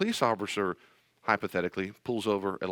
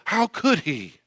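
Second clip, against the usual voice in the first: mock skeptic; 8 semitones higher, 4 dB louder, slower than usual. Mocking the skeptic's complaint.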